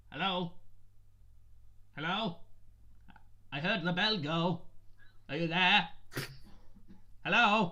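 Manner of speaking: halfling shopkeeper voice. Acted. Halfling shopkeeper calls to an unseen customer in the bookstore, high and rising. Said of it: "and kinda goes".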